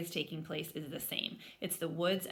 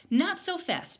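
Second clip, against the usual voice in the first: high, louder call; 12 semitones higher, 9 dB louder, slower than usual. Acted. Papa Bear calls after his running cub, high call. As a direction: shout a quick warning. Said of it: "called Dad".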